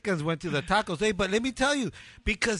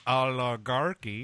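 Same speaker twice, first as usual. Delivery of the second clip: oligarchy stretched slowly, each syllable dragged; drawn out; 6 semitones lower, slower than usual. Shown as a demonstration. Mocking the long word to his co-host, slowly drawn out. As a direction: spell out every syllable of oligarchy to show how long it is. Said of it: "That's three syllables. It takes too long".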